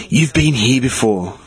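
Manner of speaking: low, very slow imitation of a creepy woman; a hint of ominous old lady, slowed right down. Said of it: "creepy old lady".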